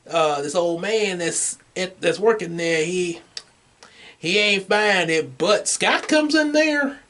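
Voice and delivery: normal talking voice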